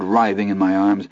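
plain reading voice

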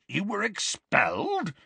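high, disbelieving question; incredulous. A narrator's reading. Hagrid reacting to the expulsion, incredulous. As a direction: echo the news in astonishment.

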